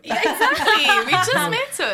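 high, loud agreement through laughter; laughing agreement. Real, not acted. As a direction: crack up while heartily agreeing. Amused agreement with co-host's joke.

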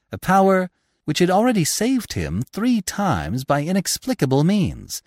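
plain reading voice

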